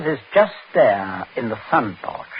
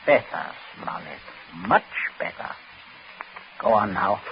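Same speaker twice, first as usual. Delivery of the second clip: quiet menace; 5 semitones lower, 7 dB quieter than usual. Acted. Gunman satisfied, menacing.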